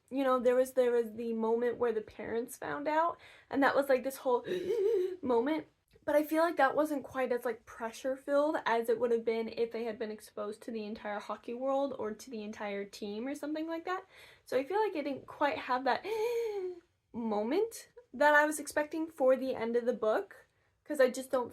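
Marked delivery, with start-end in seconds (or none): 4.5-5.2 short, high demonstrative gasp; mock gasp of alarm; shocked gasp. Shown as a demonstration. Reader showing the moment parents found out, shocked gasp. 16.1-16.7 high, sharp mock gasp; dramatic gasp. Shown as a demonstration. Reader showing the moment she expected, dramatic gasp.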